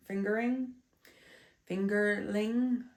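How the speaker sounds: speaking with a blocked nose; stuffy nose. Real, not acted. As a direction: talk through a stuffy, congested nose. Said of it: "You can tell I'm a little stuffy".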